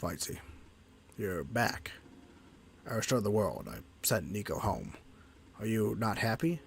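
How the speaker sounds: low companion voice. Acted. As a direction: voice the lonely companion, low and subdued. Voices Fightsy, lower.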